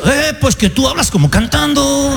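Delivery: teasing Mexican singsong; singsong Mexican accent. Retold or imitated. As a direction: imitate a Mexican accent, stretching the last word. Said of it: "venía un mexicano y me decía".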